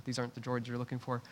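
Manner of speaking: hushed quick movie-line aside; Star Wars quote. Retold or imitated. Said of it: "Star Wars reference in there somewhere".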